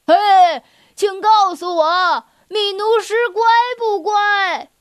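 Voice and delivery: lion shouting up to the ceiling; loud call. Acted. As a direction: call up loudly so they can hear. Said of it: "狮子只好大声地喊叫".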